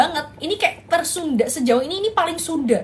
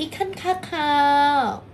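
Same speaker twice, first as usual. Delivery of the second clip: mock Thai punchline; much slower than usual. Shown as a demonstration. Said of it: "ngetik ikan kakap lagi".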